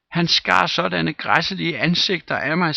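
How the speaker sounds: put-on high, loud frightened boy; child shrieking. A narrator's reading. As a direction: cry it out in terror. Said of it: "skreg drengen". (skrækslagen dreng)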